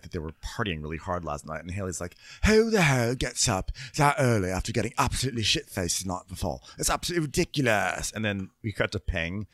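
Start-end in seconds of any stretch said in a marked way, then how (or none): 2.4-8.1 louder, higher outraged voice; hammed-up disgust at guests; imitating Haley scolding hungover guests; mock indignant rant.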